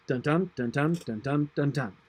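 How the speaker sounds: "dun DUN" chanted with an even stress; teacher demonstrates da-DUM meter; iambic beat. Shown as a demonstration. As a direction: tap out the iambic rhythm with syllables. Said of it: "none of those lines are regular iambic lines".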